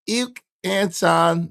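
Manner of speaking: ordinary delivery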